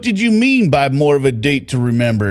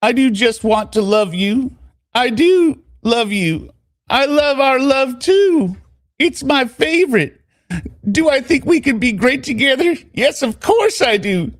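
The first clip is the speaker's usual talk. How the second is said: Corey; 14 semitones higher than usual. Acted. Answering her lover's question, high gushing voice. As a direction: declare love in a raised put-on pitch, climbing higher. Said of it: "so this is Corey again".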